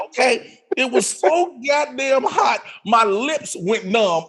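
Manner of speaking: ordinary delivery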